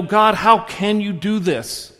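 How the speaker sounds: loud, slow, whiny protest to God; mock indignant complaint. Congregant grumbling at God over a loss, in a loud whiny protest. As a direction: complain at God like a sulking child. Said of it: "we go".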